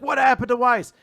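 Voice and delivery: high, loud outcry of a question; mock alarm. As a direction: cry it out as a whole startled crowd would. Voices everyone's startled question.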